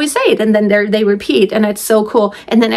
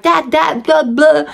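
higher-pitched mock baby gibberish; imitating baby babble; 7 semitones higher, slower than usual. Mom mimicking her little creatures before speech, babbling. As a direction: babble like a tiny child. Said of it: "these little creatures and they're like".

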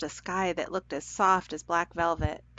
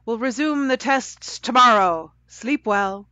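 loud, raised, ringing announcement; bell-like voice; 5 semitones higher, 9 dB louder than usual. A narrator's reading. Unseen voice sends the children to sleep, ringing out bell-like. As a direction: proclaim it grandly from on high. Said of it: "said the bell-like voice".

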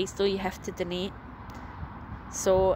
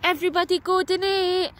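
put-on prompting a child; 11 semitones higher, 6 dB louder than usual. Shown as a demonstration.

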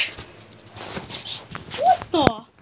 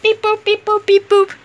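robot beeping; 4 semitones higher, 5 dB louder than usual. Pretend. Game and Watch beeps.

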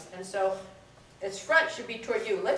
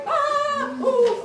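mock fright; 6 dB louder than usual. Shown as a demonstration. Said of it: "so frightened".

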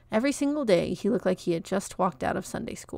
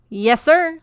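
put-on much higher and louder scraping boy's voice; sandpaper screech; 8 semitones higher, 10 dB louder than usual. A narrator's reading. Peach answering Mister Johnson in his sandpaper screech. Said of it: "sandpaper screech".

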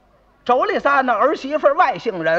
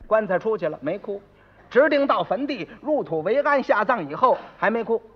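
normal talking voice

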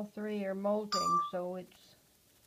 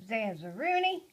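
louder, rising call of the name; 8 dB louder than usual. Real, not acted. Owner calling to her parrot Zaz upstairs, loud rising call. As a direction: call up to the bird.

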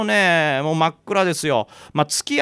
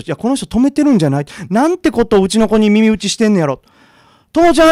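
louder, fast, alarmed outrage ending in a shout; alarmed anger; 4 semitones higher, 7 dB louder than usual. Retold or imitated. Mother realizes the old woman is harming her child, alarmed shout. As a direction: snap into fury and yell her name.

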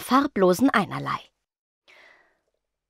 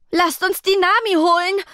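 higher, loud shout; bellowing; 10 semitones higher, 3 dB louder than usual. A narrator's reading. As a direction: yell for help across the room. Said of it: "brüllte Hudud". (laut gebrüllt)

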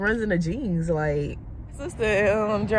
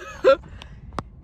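high giggle; 5 dB louder than usual. Real, not acted. Laughing at baby's face.

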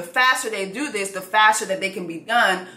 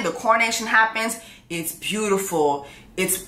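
usual speaking voice

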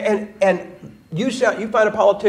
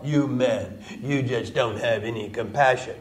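mocking scold; 8 semitones lower, 7 dB quieter than usual. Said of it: "how much stuff is all about".

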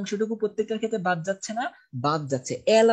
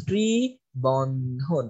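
ত্রিবন্ধন stretched out very slowly, lower; very slow dictation; 5 semitones lower, much slower than usual. Shown as a demonstration. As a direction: draw ত্রিবন্ধন out for students to write. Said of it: "হচ্ছে আমাদের ত্রিবন্ধন".

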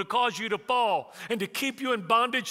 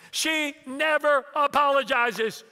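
high, loud, slow mock outrage; emphatic exasperation; 7 semitones higher, 6 dB louder, slower than usual. Son repeats his grievance to dad, slow and outraged. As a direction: draw out each word of the complaint.

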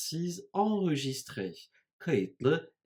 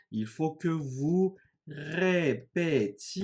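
slow, higher, "répétiez" split syllable by syllable; slow syllabic model; 4 semitones higher, slower than usual. Shown as a demonstration. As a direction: stretch out "répétiez" to show the ending. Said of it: "Imparfait gibi çekimledim".

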